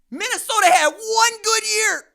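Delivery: loud emphatic rant. Real, not acted. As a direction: declare it loudly to the room. Ranting about Minnesota's single season.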